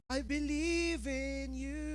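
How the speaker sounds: slow, soft, weighed-down praise; heavy-hearted worship. Acted. David forcing praise with a heavy heart, slow and soft. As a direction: sing it like the heart won't lift. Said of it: "ang bigat ng puso".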